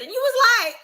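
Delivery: very high mimicked squeal. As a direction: squeak it out as a taunt.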